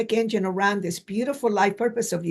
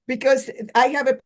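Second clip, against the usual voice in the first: usual speaking voice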